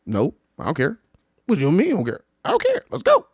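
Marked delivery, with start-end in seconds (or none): 1.5-2.2 higher, louder disbelief; mock indignant challenge. Traveler challenging companion's indifference, high and indignant. Acted bickering, challenging the answer. 2.4-3.3 very high, loud I DON'T CARE; mock fed-up insistence. Traveler insisting to companion, high, loud and exasperated. Acted bickering, insisting the second time.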